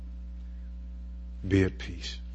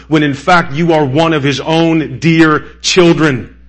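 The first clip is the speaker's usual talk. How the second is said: louder, higher, driving sermon climax; full-force preaching; 4 semitones higher, 6 dB louder than usual. Acted. Preacher proclaiming Jesus' finished work to the congregation, full-force preaching. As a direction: preach it out, hammering each phrase.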